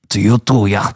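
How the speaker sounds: put-on light Swedish-Hungarian accent; reply in a loose European accent; vague European accent. Rival returning congratulations, light European accent. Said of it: "in his Swedish, Hungarian, whatever accent".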